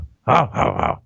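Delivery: hammy caveman grunt hoo; Tim Taylor grunt. Acted. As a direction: grunt like a tool-loving sitcom dad. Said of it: "Tim the Tool Man Taylor impression".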